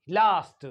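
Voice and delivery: लास्ट as 'lahst', long open vowel; British long A. Shown as a demonstration. Teacher modelling British English, long-ah लास्ट. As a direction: say लास्ट with the A of father. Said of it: "it is longer".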